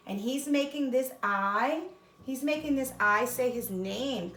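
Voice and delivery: ordinary delivery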